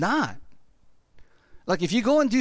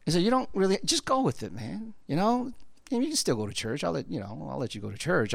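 low soothing devil voice; 9 semitones lower, 4 dB quieter, faster than usual. Retold or imitated. Devil voiced persuading churchgoer.

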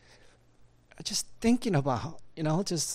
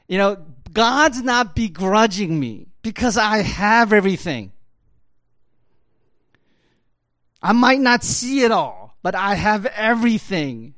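higher and louder, preaching hard; preaching at full force; 6 semitones higher, 5 dB louder than usual. Acted. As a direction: drive it home like a pulpit climax.

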